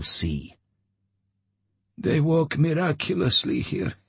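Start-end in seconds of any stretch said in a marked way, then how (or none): 2.0-3.9 chuckling. A narrator's reading. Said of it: "Homer chuckled".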